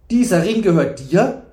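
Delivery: loud, high, incredulous question; exclaimed. A narrator's reading. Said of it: "rief Ritter Ewein".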